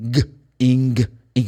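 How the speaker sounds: "ing" with a hard released G; loud, drawn-out "ing-g"; full ING. Shown as a demonstration. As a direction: isolate "ing" and punch the final G. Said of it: "the I N G should be pronounced".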